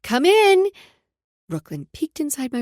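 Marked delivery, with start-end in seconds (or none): 0.0-0.7 loud high call. Acted. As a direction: call through the door brightly. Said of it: "then called".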